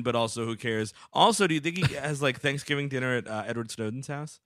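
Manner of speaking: ordinary talk, nothing marked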